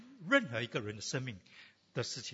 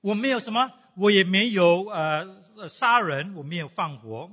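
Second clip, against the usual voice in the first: imitating a self-satisfied excuse, higher and louder; preacher mimicking a non-believer defending himself; mock non-believer; 4 semitones higher, 8 dB louder than usual. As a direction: play a proud man listing his virtues. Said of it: "你说".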